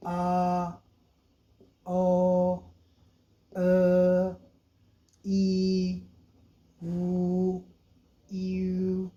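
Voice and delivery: each vowel held and sounded out clearly; Chinese finals drilled. Shown as a demonstration. Teacher demonstrating Chinese finals to students, slow clear vowels. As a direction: model vowels one at a time like a tutor. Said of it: "इन, ये finals.".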